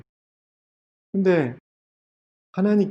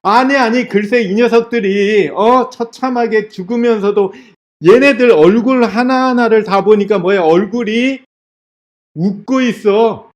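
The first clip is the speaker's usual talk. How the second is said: high, loud, exasperated ranting; acted furious bafflement, pitched up; angry outburst; 6 semitones higher, 5 dB louder than usual. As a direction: rage in disbelief, building to louder shouts. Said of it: "하고 막 화를 내요".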